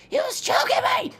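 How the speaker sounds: very high mock yell back; hammed-up squealed protest; imitated arguing aunt. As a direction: screech a defensive excuse in falsetto. Other aunt yells back in quarrel.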